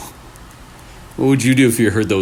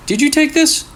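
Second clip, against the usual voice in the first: put-on much higher, anxious girl; Lena worried. Lena shows her brother Kento the photo, worried and high. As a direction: ask with rising alarm.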